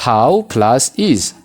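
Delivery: HOW plus IS, each said slowly and apart; two separate words. Shown as a demonstration. Teacher breaking down a contraction for learners, slow separated words. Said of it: "House jennee pronounce goona".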